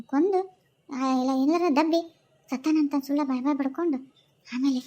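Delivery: low, hushed plotting to herself; scheming aside. Acted. Wife plotting against her in-laws, low and hushed. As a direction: mutter the plan like a schemer alone.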